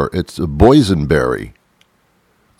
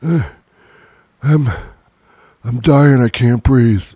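lightly hammed-up dying gasps, a bit higher; mock dying; 5 semitones higher, much slower than usual. Mock dying after eating poison berries. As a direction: play at being poisoned, half-joking.

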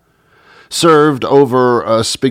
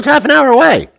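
voice went high; 4 dB louder, faster than usual. Real, not acted. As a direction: voice cracks way up on the last words. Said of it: "my voice got high".

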